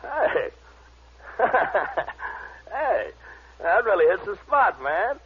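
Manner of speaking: drugged and giddy, bursting with glee; giddy and high. Acted.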